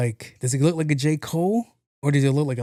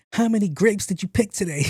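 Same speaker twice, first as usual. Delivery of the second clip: mock taunt; 7 semitones higher than usual.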